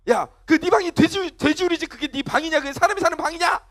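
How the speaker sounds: high-pitched acted telling-off; scolding parent. Acted parent scolding about messy room.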